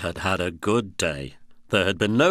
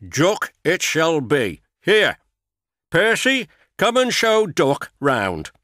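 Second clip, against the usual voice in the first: higher and louder, cheerful director; a boss calling Percy over; hearty boss; 9 semitones higher, 5 dB louder than usual. A narrator's reading. Fat Controller gives orders.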